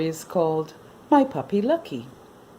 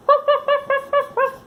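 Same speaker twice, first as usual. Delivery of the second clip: puppy yelp; 6 dB louder than usual. Acted. Puppy confused, playful sound.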